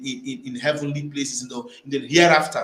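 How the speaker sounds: ordinary delivery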